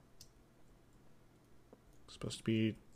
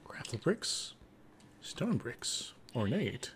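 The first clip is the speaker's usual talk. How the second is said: whispering; much slower than usual. Real, not acted. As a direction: breathe the words as a whisper. Reading block names softly.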